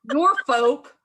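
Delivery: Norfolk squeaked out high while laughing. Real, not acted. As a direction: laugh your way through Norfolk. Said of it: "Yeah, it's Nor".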